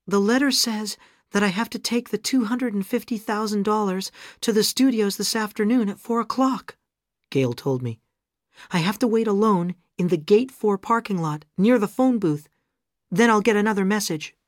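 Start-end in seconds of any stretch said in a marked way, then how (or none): 0.1-6.8 high-pitched character voice; Gail's higher voice. Acted. Narrator voices Gail on phone. 8.7-14.3 high-pitched caller relaying instructions; high character voice; Gail's higher voice. Acted. Narrator voices Gail on phone.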